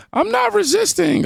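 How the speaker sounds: very high squealing parody of a yell; mock scream. Commentator mimicking the fleeing woman, high mock shriek. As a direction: shriek it up high like mocking her. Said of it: "She over there screaming".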